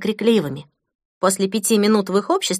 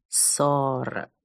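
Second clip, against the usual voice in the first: syllables drawn out, lower, softer; slow motion; 6 semitones lower, 7 dB quieter than usual. Acted. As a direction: stretch every syllable of «Сорок» out slowly. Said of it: "растягивая слоги".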